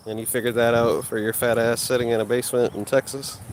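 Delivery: ordinary talk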